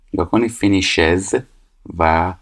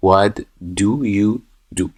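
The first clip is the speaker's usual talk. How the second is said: very slow and drawn out; slow teacher reading the question; slow read-aloud; much slower than usual. Shown as a demonstration. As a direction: say each word slowly for the class. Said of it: "reading the exercise aloud".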